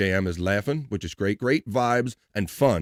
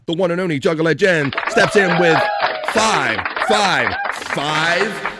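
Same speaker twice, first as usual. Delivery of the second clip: announcer hype; 5 semitones higher, 3 dB louder, slower than usual. Real, not acted. Hype host announcing gifted memberships.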